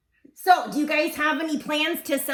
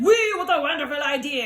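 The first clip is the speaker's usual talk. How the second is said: put-on delighted exclamation in a French accent. Picnickers greeting the idea, excited French accent. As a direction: cheer it in a hammed-up French accent. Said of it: "my French is too strong".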